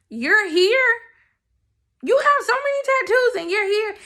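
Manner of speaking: high, loud incredulous question; astonished disbelief. Retold or imitated. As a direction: act out being amazed at who is here. Acted thought, amazed at tattooed greeter.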